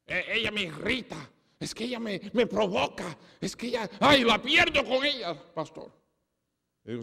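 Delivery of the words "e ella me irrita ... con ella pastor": a man whining his excuses to the pastor, pitched up; imitating a flustered man, rising higher as he blames his wife; husband making excuses to his pastor, imitated whiny and high; pleading husband. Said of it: "Un hombre me vino a mí y dijo".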